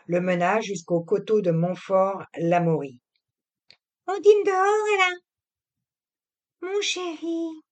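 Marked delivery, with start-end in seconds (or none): 4.1-7.6 put-on high young woman turning sweet; the wife sweet-talking her husband on the drive; young wife, coaxing. A narrator's reading. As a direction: coax him, slowing on the endearment. Narrator voices Camille.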